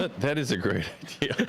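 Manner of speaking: words breaking up into laughter; laughing. Real, not acted. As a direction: say it while cracking up. Cracking up at co-host's joke.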